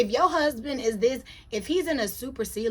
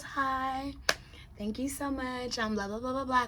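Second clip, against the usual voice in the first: soft little imitation of a polite hello; hushed, mock-polite small talk; retold polite greeting; 2 semitones higher, 7 dB quieter than usual. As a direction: replay your introduction much more quietly. Replaying own polite introduction.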